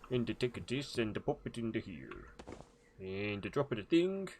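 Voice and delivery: sing-song mock-Swedish accent; playful fake Swedish cook voice; Swedish Chef voice. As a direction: lilt it in a comic Swedish accent. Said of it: "the Swedish chef voice".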